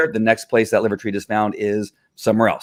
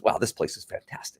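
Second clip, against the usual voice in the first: hushed, awed voice, much softer; amazed dog; 11 dB quieter than usual. Acted. The dog finding treats at the house, hushed amazement. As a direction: breathe it out in soft wonder. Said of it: "now the dog goes".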